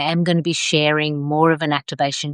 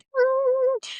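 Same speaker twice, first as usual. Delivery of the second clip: high-pitched uneasy hum; nervous hum; 3 dB quieter than usual. Retold or imitated. Coach showing how free fall feels, uneasy high hum. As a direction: squeak out a nervous mm at the freaky fall. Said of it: "There's a little bit of, like".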